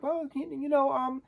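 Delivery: high, hesitant frog voice; Kermit. Acted. Frog hesitates over marrying Miss Piggy, high and halting. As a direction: hem and haw in a high puppet voice. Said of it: "Kermit's very hesitant about marrying".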